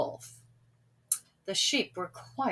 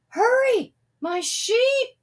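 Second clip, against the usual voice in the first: urgent cry; 6 dB louder, slower than usual. A narrator's reading. Sam urging villagers up.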